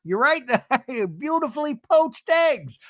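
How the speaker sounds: high-pitched exaggerated reality-husband voice; loud-edged, high mock Seth; Seth imitation. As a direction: push it high and goofy, overblown. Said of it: "my Seth imitation".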